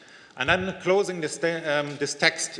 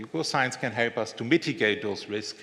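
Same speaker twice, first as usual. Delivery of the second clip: ordinary talk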